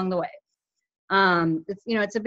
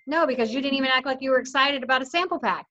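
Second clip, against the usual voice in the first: normal talking voice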